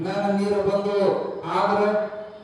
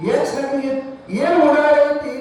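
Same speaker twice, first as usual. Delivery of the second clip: sharp retort; 6 semitones higher, 5 dB louder than usual. Acted.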